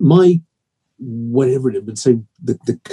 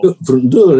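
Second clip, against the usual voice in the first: loud mock Yorkshire; Yorkshire accent; 7 dB louder than usual. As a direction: echo it in a put-on Yorkshire accent. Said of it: "in a Yorkshire accent".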